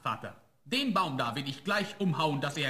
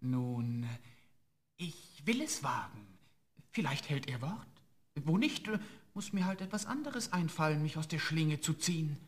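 softer, private musing; thinking to himself; 5 dB quieter than usual. Acted. Student weighs freeing the ghost again, soft inner musing. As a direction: weigh the risk quietly to yourself. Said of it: "Er dachte bei sich".

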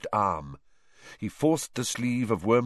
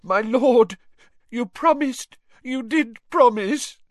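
high, choked begging; whimpering servant voice; desperate pleading; 6 dB louder than usual. Acted. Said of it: "he choked".

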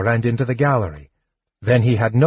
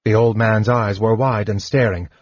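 ordinary delivery, nothing marked

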